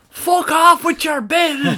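high, slow shouted abuse; angry fan yell. Retold or imitated. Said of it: "giving out stink".

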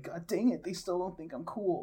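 put-on frustrated kid. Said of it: "And I'd be like".

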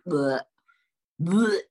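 loud disgusted blech; mock gagging noise; retching blech. Real, not acted. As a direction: gag at the taste. Disgust at the flavour.

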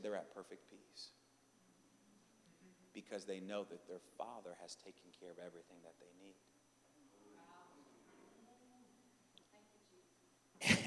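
very soft, low Holy Spirit voice; hushed divine answer. Retold or imitated. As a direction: drop to a deep hush, letting it sink in.

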